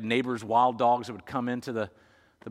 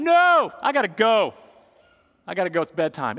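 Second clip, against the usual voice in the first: put-on high, loud little boy wriggling away; kid voice backing out; 8 semitones higher, 9 dB louder than usual. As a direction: squeak out an excuse to leave.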